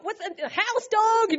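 teasing slang impression, rising on dog; mock street slang. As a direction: ham up the street kid greeting his buddy. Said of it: "No, I never talk that".

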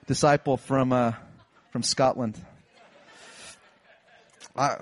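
ordinary talk

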